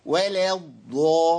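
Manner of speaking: slow ولا with the A tilted to "leh" before a heavy الضا; thin the vowel of ولا right toward E, then hit الضا; imala. Shown as a demonstration. Teacher mimics a reader separating lam from Dad, imala on ولا. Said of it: "أعمل إمالة، أقول إيه؟".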